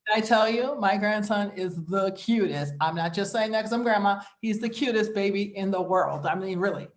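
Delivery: ordinary talk, nothing marked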